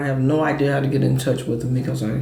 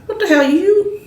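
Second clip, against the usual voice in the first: high, loud, incredulous objection; indignant protest; 8 semitones higher, 4 dB louder than usual. Real, not acted. Host objects to co-host co-signing, indignant. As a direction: protest in raised-pitch disbelief.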